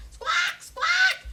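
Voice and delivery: high, loud bird squawks; shrill bird screech. A narrator's reading. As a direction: screech like greedy birds.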